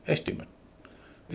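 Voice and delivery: Estimate as ES-ti-mut; first-syllable stress. Shown as a demonstration. Teacher repeating the noun softly, estimate as ES-ti-mut. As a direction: let the ending of estimate weaken to "mut". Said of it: "पहले पर stress".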